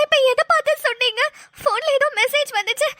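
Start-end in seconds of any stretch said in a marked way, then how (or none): none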